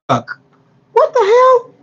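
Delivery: very high, drawn-out squeal of disbelief; stunned. Real, not acted. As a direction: stretch it out, shocked and high. Mock outrage at food truck idea.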